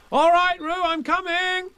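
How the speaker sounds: loud, high call across the water. A narrator's reading. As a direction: shout to Roo. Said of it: "called Christopher Robin".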